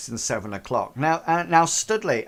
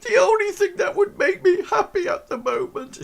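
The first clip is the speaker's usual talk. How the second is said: high, tearful falsetto wail; crying; 3 dB louder than usual. Acted. Erin cries to her visitor about what she's missing, crying. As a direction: play a sobbing girl in squeaky falsetto. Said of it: "like, crying".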